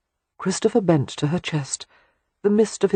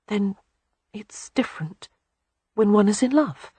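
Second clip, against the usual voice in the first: wondering whisper; 6 semitones higher than usual. A narrator's reading. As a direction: ask it in breathless wonder. Wondering, breathless question.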